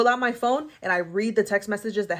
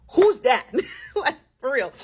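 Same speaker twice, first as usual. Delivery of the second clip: laughing; 6 semitones higher, 4 dB louder than usual. Real, not acted. Laughing at mock accusation.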